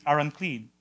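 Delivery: ordinary talk, nothing marked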